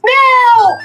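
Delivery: very high, loud drawn-out wail; piercing imitated cry of horror; mock scream. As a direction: scream it like a victim seeing doom. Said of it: "all you hear is this".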